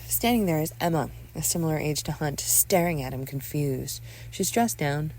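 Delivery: ordinary talk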